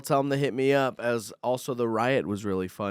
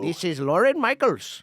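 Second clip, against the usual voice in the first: higher put-on Indian accent; 6 semitones higher, 4 dB louder than usual. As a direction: another go at the scam caller voice. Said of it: "That's not bad".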